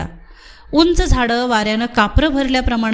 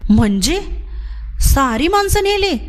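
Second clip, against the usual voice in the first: rising astonished question, much higher on नेली; 6 semitones higher than usual. Acted. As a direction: disbelief that everyone was taken. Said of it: "बळीनं आश्चर्यानं विचारलं". (आश्चर्याने उंच स्वरात विचारणे)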